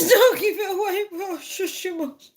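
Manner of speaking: high squeaky laughing; laughing mock scold. Real, not acted. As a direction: shush them through giggles.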